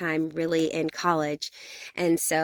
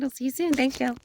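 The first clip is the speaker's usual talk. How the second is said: ordinary talk, nothing marked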